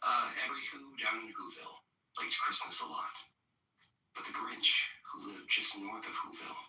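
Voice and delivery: lightly performed bedtime story reading. Acted. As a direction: read it like a Dr. Seuss book to friends. Reads The Grinch aloud.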